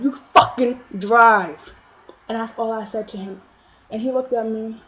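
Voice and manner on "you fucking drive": loud, very slow, word-by-word order; loud slow command. Retold or imitated. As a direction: spell it out to him, furious.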